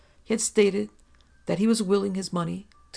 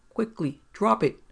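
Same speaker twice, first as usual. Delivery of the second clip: urgent command; 4 semitones higher, 6 dB louder than usual. Acted. Gun raised, ordering woman.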